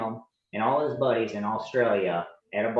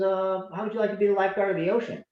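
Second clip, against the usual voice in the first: high, fast, eager sales pitch; salesman pitch; 9 semitones higher, faster than usual. Retold or imitated. Recruiter hooking the young lifeguard, bright quick sales pitch. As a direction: dangle the offer like a prize.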